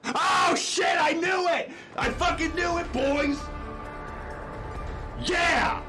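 excited shout. Real, not acted. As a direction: shout it out like you called it. Gamer celebrating a correct guess.

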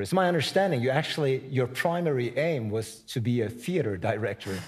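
normal talking voice

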